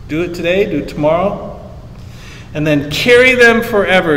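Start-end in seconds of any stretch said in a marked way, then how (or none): 2.9-4.2 "Carry them forever" pushed higher, stress on "Carry"; emphatic. Shown as a demonstration. Pastor explaining the verse, emphatic on "Carry". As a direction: press down on "Carry" like a firm command. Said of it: "is emphatic".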